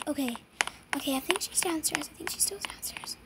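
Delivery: fast nervous whisper; sneaking whisper to the viewers. Real, not acted. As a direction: whisper so she won't hear. Sneaking around, scared of her.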